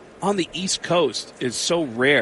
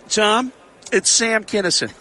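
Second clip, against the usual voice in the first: a hint of Sam Kinison, pitched higher; Sam Kinison impression; 6 semitones higher than usual. Prank caller posing as Sam Kinison to Tom Arnold, light put-on. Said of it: "And I said".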